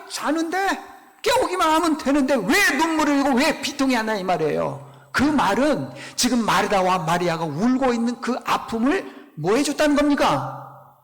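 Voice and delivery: ordinary talk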